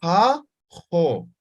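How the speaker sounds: ja, jo both scraped in the throat; rasped ja jo. Shown as a demonstration. Teacher models the x in ja and jo. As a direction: rasp through ja then jo. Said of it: "aquí es".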